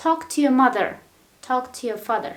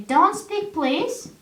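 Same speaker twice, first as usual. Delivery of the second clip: polite request; 6 semitones higher, slower than usual. Shown as a demonstration. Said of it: "you want to sound polite".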